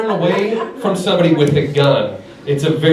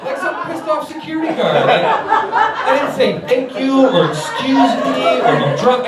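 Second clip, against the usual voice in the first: high, slow, mocking stepfather disapproval; disapproving stepfather; 10 semitones higher, slower than usual. Comic describes pointing at the woman, slow mock disapproval. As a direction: act out a silent scolding stepfather. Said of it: "like a disapproving stepfather".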